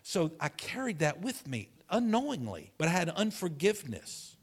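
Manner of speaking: normal talking voice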